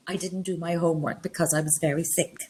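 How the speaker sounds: soft, feigned sick-note excuse; student's false excuse. Acted. Student tries the sick excuse on teacher, softly feigned. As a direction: offer a put-on excuse quietly. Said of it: "you come in and you say".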